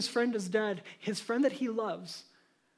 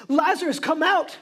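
a commanding shout into the tomb; loud shout; 3 semitones higher, 7 dB louder than usual. A narrator's reading. Jesus calling Lazarus from the grave, loud command. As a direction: call the name out loud. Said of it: "he shouted with a loud voice".

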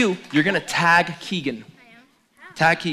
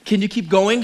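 usual speaking voice

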